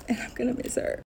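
soft sad whisper. Real, not acted. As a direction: breathe it out softly. Sad goodbye to coworker.

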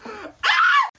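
high delighted scream; excited shriek. Real, not acted. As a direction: squeal with joy. Excited scream over a package.

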